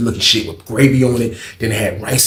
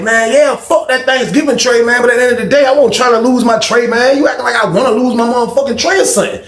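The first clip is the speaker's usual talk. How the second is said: heated retort; 12 semitones higher, 6 dB louder than usual. Retold or imitated. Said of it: "I'm like".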